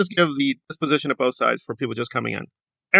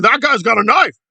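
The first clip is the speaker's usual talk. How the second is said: high, loud whiny cries of alarm; mock fake panic; 10 semitones higher, 5 dB louder than usual. Commentator mimicking the activist warning everyone, hammed-up panic. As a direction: overplay a frightened warning to the crowd. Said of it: "fake panic over nothing".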